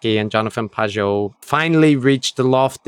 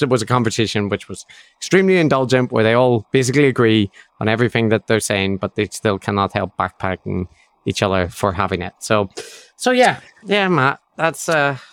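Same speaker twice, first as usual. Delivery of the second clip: usual speaking voice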